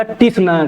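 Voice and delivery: "टी सुना-" with a spoken "tee" in front, broken off; T wrongly added. Shown as a demonstration. Teacher warning students of an exam mistake, "टी सुना-" with T sounded. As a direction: begin "टी सुना-" with a separate "tee" and cut it short. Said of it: "गलत हो जाएगा".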